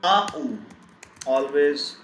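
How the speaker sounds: ordinary talk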